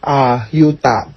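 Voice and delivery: ordinary delivery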